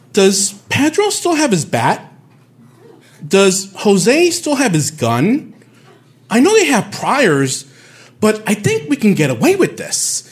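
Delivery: high, slow mock-sinister plotting voice; scheming gangster girl. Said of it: "this girl is saying stuff in English like".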